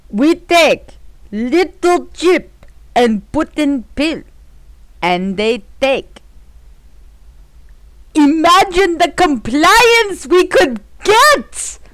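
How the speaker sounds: put-on slow, heavy Russian villain accent; menacing Bond villain in a Russian accent. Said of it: "I'm gonna use some crazy Russian accent for this".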